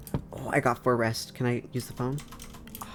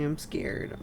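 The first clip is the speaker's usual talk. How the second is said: whispered fear; 4 semitones higher, 6 dB quieter than usual. Acted. Voicing a scared visitor.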